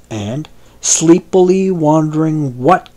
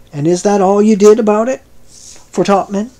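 put-on higher, fast, gruff old sailor; gruff demand; 7 semitones higher, faster than usual. Irascible old sailor questioning Billy, gruff fast demand. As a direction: bark the question at him. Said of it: "gruffly demanding another".

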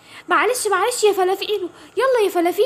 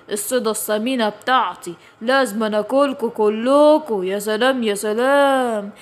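low, greedy predator voice savoring the meal; gloating hungry tiger; 6 semitones lower than usual. Acted. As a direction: relish the fat prey like a cartoon villain. Tiger gloats over prey. (نمر شرير جعان بيتلذذ)

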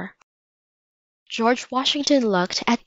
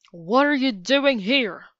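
higher-pitched, indignant demand; furious; 4 semitones higher than usual. A narrator's reading. George Washington confronts the stowaway children, sounding furious. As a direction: demand an answer as an angry commander. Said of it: "The commander-in-chief sounded furious".

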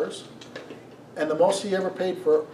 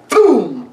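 loud mock boom; 4 semitones higher, 12 dB louder than usual. Retelling barista slamming things.

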